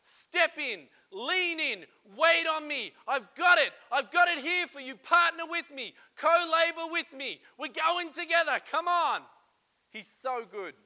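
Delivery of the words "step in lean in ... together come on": raised, rousing voice of God calling out; God urging. Acted. God calling the listener to lean in, loud and rousing. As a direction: urge them loudly to step in. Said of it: "He put it on your heart to say".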